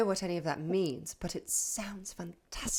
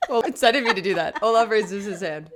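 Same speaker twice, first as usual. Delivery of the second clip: laughing; 7 dB louder than usual. Real, not acted. Embarrassed laughter after outburst.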